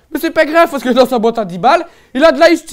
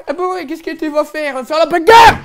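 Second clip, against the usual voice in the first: higher, loud mock mum with a laugh; mock mother; 5 semitones higher, 6 dB louder than usual. Mother teases her son about fighting, loud mock voice. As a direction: play the mum, teasing and loud.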